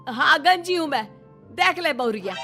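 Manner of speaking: loud, high defiant retort; cornered mother-in-law lashing out; exasperated admission. Acted. Caught bald, snaps at daughter-in-law.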